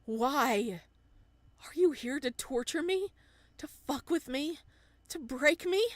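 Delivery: high, loud, desperate accusations; anguished shouting. Acted. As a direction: shout at her, close to breaking. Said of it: "His voice cracks and fissures".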